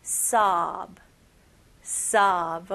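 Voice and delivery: "sob" with an open, unrounded "ah"; jaw-dropped vowel. Shown as a demonstration. Teacher showing learners the sound, jaw-dropped "sob". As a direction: drop the jaw on "sob", lips relaxed. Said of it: "턱만 벌리는 느낌으로".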